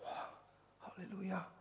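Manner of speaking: breathless whispered wow; hushed in awe, almost no voice; awed whisper. Retold or imitated. Remembering his tears before God, whispered in awe. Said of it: "Ich sagte".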